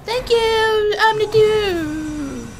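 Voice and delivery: high, drawn-out thank you; sing-song thanks. Pretend.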